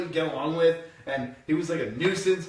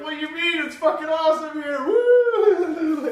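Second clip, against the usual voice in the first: much higher, whooping party voice; mock gleeful; 13 semitones higher, 6 dB louder, slower than usual. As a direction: gloat at the stranded pair. Gloating party voice.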